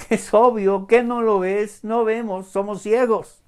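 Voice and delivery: high, loud, exasperated pointing out; incredulous protest. Acted. Blind men telling Jesus they cannot see, incredulous. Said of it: "Ellos podrían decir".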